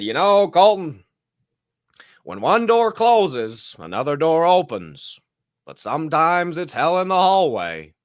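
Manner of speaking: lightly voiced older mentor's advice; quoting Mister D. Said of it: "And he said".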